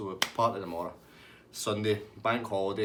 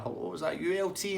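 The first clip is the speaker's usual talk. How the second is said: high-pitched intercom greeting; Tasty Dave; 9 semitones higher than usual. Retold or imitated. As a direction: imitate him hopefully calling up on the intercom. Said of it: "And he's like".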